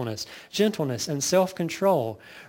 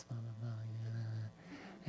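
very quiet, quick, low murmur; 6 semitones lower, 20 dB quieter, slower than usual. Shown as a demonstration. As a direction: mumble it under your breath, like hallway gossip. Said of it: "we're just kinda talking like this".

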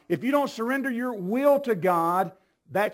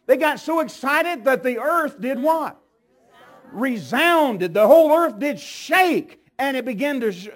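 usual speaking voice

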